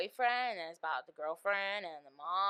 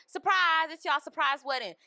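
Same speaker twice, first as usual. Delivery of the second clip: higher, louder cheery announcement; mock party host; 7 semitones higher, 7 dB louder than usual. Mocking the movie's surprise wedding, loud cheery host.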